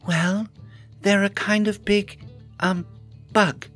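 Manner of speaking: high, hesitant hedging with pauses; awkward, stalling answer; odd, evasive tone. A narrator's reading. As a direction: pick the words haltingly in a high voice. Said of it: "an odd tone in her voice".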